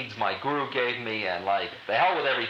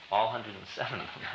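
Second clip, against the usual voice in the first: normal talking voice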